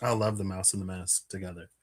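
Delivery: ordinary delivery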